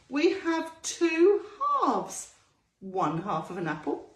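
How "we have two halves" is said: teacherly emphasis. Acted.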